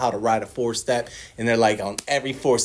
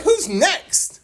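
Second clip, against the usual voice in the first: high, pressing demand; retold exasperated prompt; impatient sponsor; 3 dB louder than usual.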